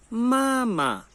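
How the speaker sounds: "mama" with a long high first "ma" and a short light second; first tone, then neutral. Shown as a demonstration. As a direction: hold the first syllable of "mama", let the second drop lightly. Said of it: "palavra de primeira entonação".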